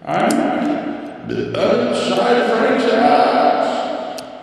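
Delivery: very slow, high, whispered as if echoing; hollow reverb-style whisper; mock echo voice. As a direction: stretch each word like a voice in a cave. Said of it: "a reverb or something".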